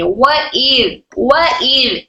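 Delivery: व इर with the hamza cut off, said slowly; separate hamza. Shown as a demonstration. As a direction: say व and इर apart, with a clean break at the hamza. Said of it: "इस तरह से अलिफ को अलग से पढ़ा जाएगा".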